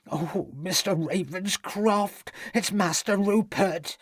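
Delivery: the cook blurting out her fear; agitated. A narrator's reading. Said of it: "she said in an agitated state".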